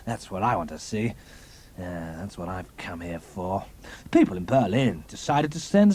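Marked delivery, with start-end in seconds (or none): 0.1-3.6 vindictive relish. Acted. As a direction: savour every word of the threat. Spy relishing planned execution.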